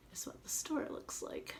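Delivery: hushed whisper. Real, not acted. Aside to kids showing picture.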